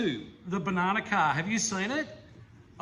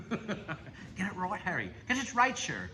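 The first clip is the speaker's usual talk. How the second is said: mock Irish accent; 2 semitones higher, slower than usual. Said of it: "I can do the Irish one".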